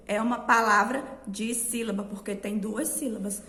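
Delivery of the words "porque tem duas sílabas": ordinary talk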